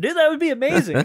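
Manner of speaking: sudden high, loud burst of delight; light up at the idea; excited. Real, not acted. To his co-host picturing a Star Fox game, thrilled.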